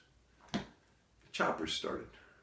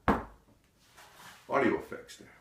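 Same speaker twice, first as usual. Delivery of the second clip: plain reading voice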